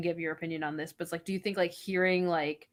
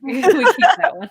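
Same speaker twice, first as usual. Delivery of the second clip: laughing; 10 semitones higher, 16 dB louder than usual. Real, not acted. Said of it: "laughing repeat".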